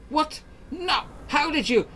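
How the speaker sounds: very high, loud, stunned cry; shocked outburst. Acted. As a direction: shriek in disbelief. Entity's name spoken, outraged.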